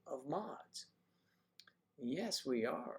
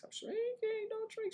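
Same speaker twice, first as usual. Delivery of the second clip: very high squeaky mock cry; mock shrinking cry. Host pretends to shrink while resizing the screen, high squeaky cry.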